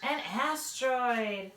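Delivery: lively read-aloud with character voices; bright storybook reading for little listeners; reading to children. Acted. Mom reads a picture book to second graders, lively and animated.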